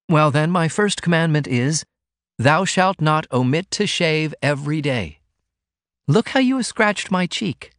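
hammed-up royal decree, teasing; Ruth, playfully commanding. Ruth teases Martin with a mock royal command, playful.

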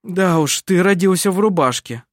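sour, grudging congratulation; sour remark. A narrator's reading. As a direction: say it with envious irony. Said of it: "кисло заметил Адриан Колю".

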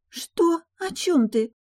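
put-on high, girlish, questioning voice; puzzled bride. Bride asking her groom, puzzled. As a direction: ask in confusion, pitched high.

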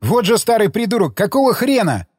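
high, indignant call; exasperated shout. A narrator's reading. Alftan shouting after the departing Shestakov, exasperated. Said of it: "крикнул вдогонку Альфтан".

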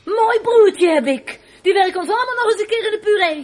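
angry outburst. Acted. Sister ranting angrily about her runaway brother. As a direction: lash out in bitter fury.

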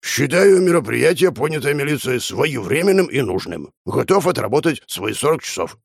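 a lightly voiced old pensioner, formal; old man speaking at a meeting. A narrator's reading. Old Prokhor rises and addresses the room, mildly formal. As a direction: read it like a stiff speech at a meeting. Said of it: "сказал".

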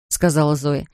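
ordinary delivery, nothing marked

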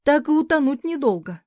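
startled grumble. A narrator's reading. Mother woken in the bath by the cat, startled.

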